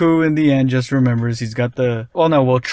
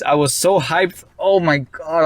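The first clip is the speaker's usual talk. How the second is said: ordinary delivery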